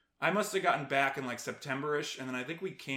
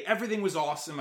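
ordinary delivery

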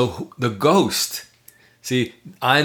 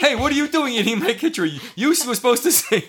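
much higher, laughing mock complaint; mock indignant; 12 semitones higher, faster than usual. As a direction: whine like a cheated child. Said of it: "part of me is like".

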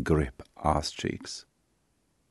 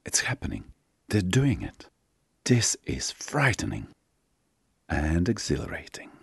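whispered, as if barely daring to say it; hushed thoughts in a half-whisper; 4 semitones higher than usual. A narrator's reading. Two lovers realising they are doing it, whispered.

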